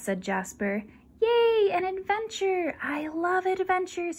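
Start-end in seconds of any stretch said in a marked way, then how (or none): 1.2-4.2 high squealing cheer; cheering puppy. Acted. Scruff cheering about the adventure, high and squealing.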